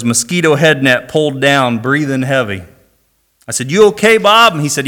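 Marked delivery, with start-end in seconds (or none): 3.7-4.5 called out, higher and louder; finding Bob under the canoe, calling out to him. Retold or imitated. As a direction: call down to him in the swamp. Calling to fallen friend.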